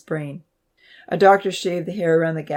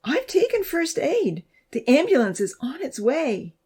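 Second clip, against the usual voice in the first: pleading; 10 semitones higher than usual. A narrator's reading. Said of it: "she pleaded".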